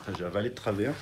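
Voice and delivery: coughing, swallowed wrong; sputtering after choking. Real, not acted. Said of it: "je suis en train de m'étouffer". (qui s'étouffe en toussant)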